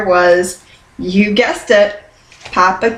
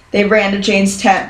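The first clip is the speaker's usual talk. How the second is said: ordinary delivery, nothing marked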